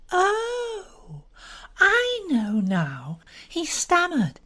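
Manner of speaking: put-on drawn-out delighted young woman's voice; Frankie, amused recognition. To Bobby, a young woman suddenly remembering Badger, amused.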